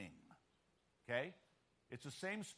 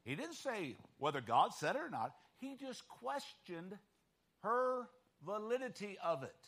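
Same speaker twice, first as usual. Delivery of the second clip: ordinary talk, nothing marked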